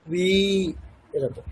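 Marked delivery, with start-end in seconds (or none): 0.1-0.3 কুই with a lengthened ই; demonstrated pronunciation. Shown as a demonstration. Teacher modelling long ঈকার in কুই for students. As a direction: hold the ই of কুই. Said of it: "টানতে হবে".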